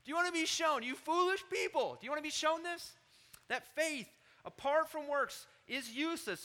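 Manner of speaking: high, loud rebuke to a crowd; addressing the assembly. Acted. James rebuking the entire assembly as foolish people, loud scolding. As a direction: call it out over the whole congregation. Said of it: "talking to the entire assembly".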